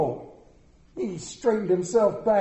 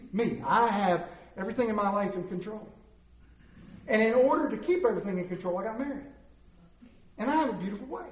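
normal talking voice

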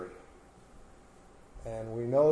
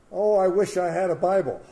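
retold wishing, higher and louder; wishful pastor; 6 semitones higher, 9 dB louder than usual. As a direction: call out a wish as the pastor. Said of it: "And he said".

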